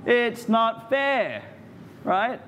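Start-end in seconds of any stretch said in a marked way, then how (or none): 0.0-1.4 put-on slow, drawn-out kid's whine; childish whine. Shown as a demonstration. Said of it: "Everyone say it with me".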